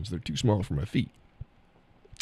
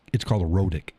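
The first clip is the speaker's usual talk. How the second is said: ordinary talk, nothing marked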